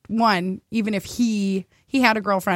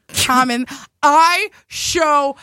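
usual speaking voice

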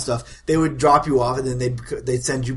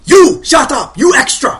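high, loud mock outburst; over-the-top, high-pitched shout; hammed-up movie shout; 12 semitones higher, 12 dB louder than usual. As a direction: yell it like a corny movie line. Villagers parrot movie lines, yelling.

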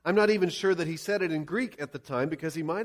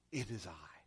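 very low, soft voice; quiet reassurance; 13 semitones lower, 15 dB quieter than usual. Acted. Jesus telling the disciples not to fear, quiet reassurance. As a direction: say it softly and low, to calm them.

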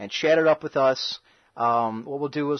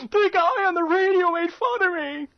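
high, whiny complaining voice; whiny victim voice. Retold or imitated. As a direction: whine it like a hurt kid. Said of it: "This poor guy is now gonna go in front of counseling".

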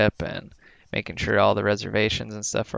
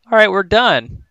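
breezy relief; 9 semitones higher, 6 dB louder than usual. Retold or imitated. Quoting own relieved attitude.

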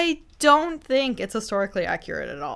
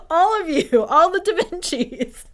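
higher, louder laughing voice; 11 semitones higher, 7 dB louder, slower than usual. Real, not acted. Host still cracking up over the da Vincis. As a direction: talk through the giggles.

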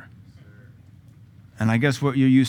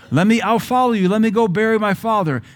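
high, louder pleading excuse; whiny follower; 9 semitones higher, 5 dB louder than usual. Retold or imitated. Preacher mocking a follower's excuse, high and whiny. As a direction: beg off in a raised whine.

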